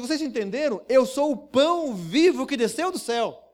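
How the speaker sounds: preacher voicing Jesus proclaiming boldly; defiant proclamation. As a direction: hammer it at the crowd. Jesus defiantly repeats to crowd.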